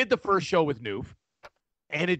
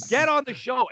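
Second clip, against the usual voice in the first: wife yelling; 8 semitones higher than usual. Retold or imitated. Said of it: "so she's like".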